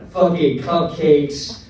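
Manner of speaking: ordinary talk, nothing marked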